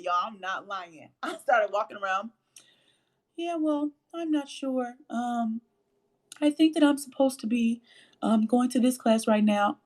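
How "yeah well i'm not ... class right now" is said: high, put-on soprano speaking voice; faked soprano voice. Shown as a demonstration. Student faking a soprano voice on the way to class, high and put-on. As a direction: talk way up high on the breath, affectedly. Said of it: "I started walking around talking like that".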